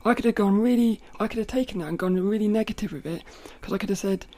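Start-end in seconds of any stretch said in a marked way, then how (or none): none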